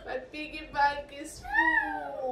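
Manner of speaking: slow, drawn-out excited cry; excited shout. Real, not acted. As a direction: shout it with joy, stretching words. Mother excitedly echoes daughter.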